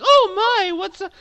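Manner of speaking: high-pitched fussing lady; worried lady. Acted. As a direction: voice a startled woman rushing over, very high. GM voices alarmed lady running over.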